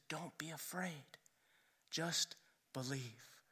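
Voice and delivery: very soft, low, hushed; slow, tender comfort with a pause; gentle reassurance. Retold or imitated. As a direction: reassure almost in a whisper. Jesus reassures the grieving father.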